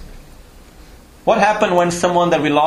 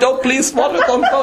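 high, hammed-up whiny plea, breaking into a laugh; mock pleading wail; 10 semitones higher than usual. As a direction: beg the water like a crybaby, much higher. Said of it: "I'm there worried".

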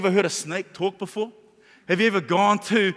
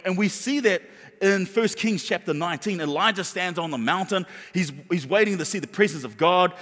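usual speaking voice

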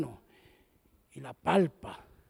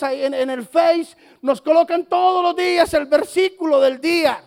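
imitating a churchgoer's higher, dismissive excuse; mock excuse; 4 semitones higher, 4 dB louder than usual. Member excuses himself to pastor, higher and dismissive. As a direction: brush it off, raised pitch.

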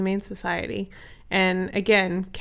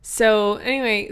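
plain reading voice

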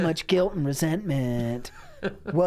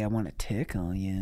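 creepy low ghost voice; 9 semitones lower, 6 dB quieter than usual. Pretend. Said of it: "He sounds just like [name]".

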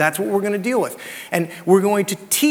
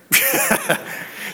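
a quick high laugh under the breath; soft breathy laugh; 4 dB quieter than usual. Real, not acted. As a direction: chuckle softly at yourself. Laughs at kids in room.

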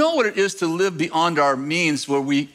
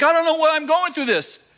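mock whine; 12 semitones higher than usual. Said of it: "You're like".

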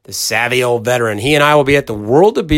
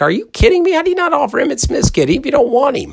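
much higher, exasperated disbelief; incredulous; 12 semitones higher than usual. Retold or imitated. Host to the son about Florida not offering, incredulous. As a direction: throw up your hands at the snub.

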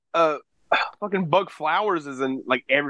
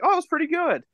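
much higher, mock delighted; pleasantly surprised; 13 semitones higher than usual. Recalling the first film of a box set, high mock delight. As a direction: chirp it like a happy discovery. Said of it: "I was like".

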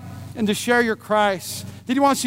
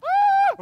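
high thrilled yelp; hammed-up cry of joy; excited yelp. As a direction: squeal it with excitement. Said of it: "I'm all excited".

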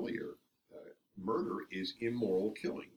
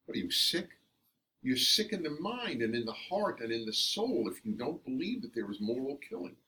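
higher, louder indignant outburst; angry; 4 semitones higher, 3 dB louder than usual. Real, not acted. [name] condemns those who deny moral killing, angrily. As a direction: rebuke them hard. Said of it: "I am angry at these people".

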